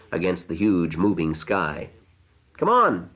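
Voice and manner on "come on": high, carrying call; shouting. A narrator's reading. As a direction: call out into the wind. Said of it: "she shouted".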